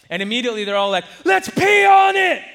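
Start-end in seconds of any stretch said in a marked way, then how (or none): 1.3-2.4 high, loud, slow gleeful shout; eager shout. Retold or imitated. Cousins eagerly shout a cure for the sting, gleeful shout. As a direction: yell it like kids with a gross idea.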